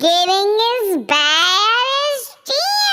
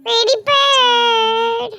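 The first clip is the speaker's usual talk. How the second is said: pet talk; 6 semitones higher than usual. Real, not acted. Cooing to her pet bird.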